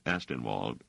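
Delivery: usual speaking voice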